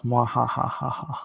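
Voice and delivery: slow mock villain cackle; evil laugh. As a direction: mad scientist mwahaha, slow. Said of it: "Someone is evil laughing".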